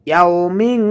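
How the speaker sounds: "ইয়াউমিন" ending in a held nasal hum; ghunnah. Shown as a demonstration. Teacher showing ghunnah before zal, long nasal end on "ইয়াউমিন". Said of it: "এখানেও গুন্না হবে".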